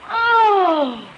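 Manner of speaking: loud, high "oh" of horror; dramatic high-pitched cry of dismay; horrified cry. Acted. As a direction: wail out in shock. Mother reacts with horror.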